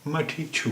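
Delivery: छू said with a clear ending "u", not छा; Nepali pronunciation. Shown as a demonstration. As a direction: say "मैं ठीक छू" ending on a rounded छू. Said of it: "मैं ठीक छा" नहीं होता है". ("छू" को उ की आवाज़ के साथ बोलिए)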